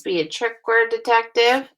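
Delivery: ordinary talk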